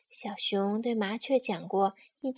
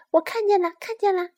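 eager agreeing; 7 semitones higher, 7 dB louder, much faster than usual. Acted. Sparrow eagerly humours the bear.

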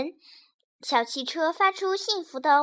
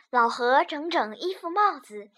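ordinary delivery, nothing marked

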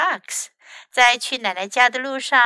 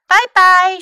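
high, loud bye-bye; breezy high-pitched goodbye call; pretty fox farewell; 8 semitones higher, 11 dB louder than usual. A narrator's reading. Pretty fox leaves with the chocolates, high calling voice.

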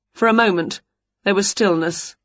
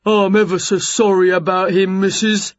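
put-on giant's voice, firmly projected; big, simple fellow saying sorry; apologetic giant neighbour; 3 dB louder than usual. A narrator's reading. Jerry apologising to Winnie about his dog, apologetic.

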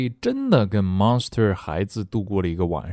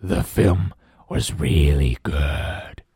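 slow, much lower happy monster voice; smiling monster; 7 semitones lower, much slower than usual. Acted. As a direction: beam it out like a contented beast. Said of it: "smiled Blob".

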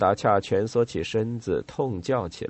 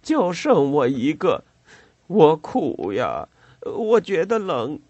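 a broken, tearful plea; sobbing complaint; 6 semitones higher, 6 dB louder than usual. A narrator's reading. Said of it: "潘达恰抽泣起来".

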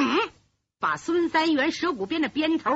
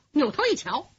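ordinary delivery, nothing marked

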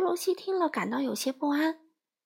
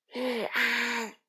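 very hoarse. Acted. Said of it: "用非常沙哑的声音说".

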